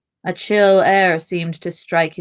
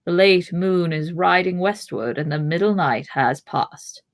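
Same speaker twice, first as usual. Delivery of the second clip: plain reading voice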